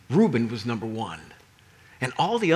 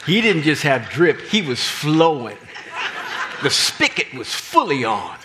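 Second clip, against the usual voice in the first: excited; 7 dB louder than usual. Real, not acted. Preacher hyping the coat.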